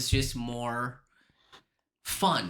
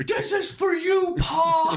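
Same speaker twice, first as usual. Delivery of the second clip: high, loud, drawn-out shout; hammed-up heroic cry; 6 dB louder, slower than usual. Son dedicating it to his father, hammed-up cry.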